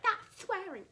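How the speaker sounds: very high, loud mock reprimand; exaggerated high-pitched telling-off; outraged teacher imitation. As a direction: mimic a scandalised teacher crying out. Mimicking teacher's outraged reproach.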